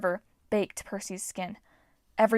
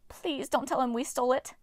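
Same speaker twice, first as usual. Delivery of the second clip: lightly raised pleading dwarf voice; begging dwarf; 4 semitones higher than usual. A narrator's reading. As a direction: beg him not to tell. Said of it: "Pasilos begged".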